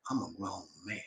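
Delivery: very soft, low declaration; firm demonstration. Shown as a demonstration. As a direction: drop to a near-hush and mean it. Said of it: "When you tell her like that".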